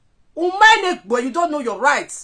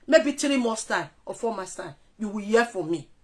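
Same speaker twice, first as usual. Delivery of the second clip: much softer, low menace; quiet threat; 6 semitones lower, 10 dB quieter than usual. Real, not acted. Threatening a rival softly and low. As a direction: lower the voice to threaten.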